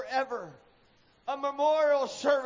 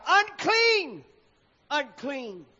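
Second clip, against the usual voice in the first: cried out; 3 semitones higher, 4 dB louder, much slower than usual. A narrator's reading. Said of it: "and shall cry".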